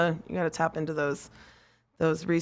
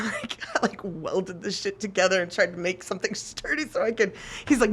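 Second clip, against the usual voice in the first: ordinary delivery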